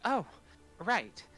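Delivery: high, sudden realisation; startled helper. Acted. Helper answering the knight's summons, high and startled. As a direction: pipe up as if just reminded. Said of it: "and she's like".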